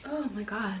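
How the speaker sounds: real emotion welling up, almost breaking; choked up. Real, not acted. Telling her story and tearing up, real choked-up voice. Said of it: "I'm not about to cry".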